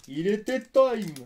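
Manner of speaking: slow, high, loud drawn-out cheer; slow excited drawl. Real, not acted. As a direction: stretch out every word. Slow, drawn-out joking variant.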